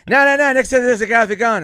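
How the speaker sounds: high, loud insistence; mock panic. Norm insisting there's a gunman, loud mock panic. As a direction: insist in alarm.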